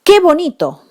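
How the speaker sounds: high, loud delighted exclamation; exclaimed. Shown as a demonstration. Teacher modelling an exclamative qué, delighted. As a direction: cry it out in delight.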